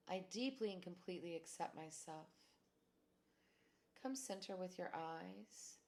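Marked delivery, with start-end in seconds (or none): none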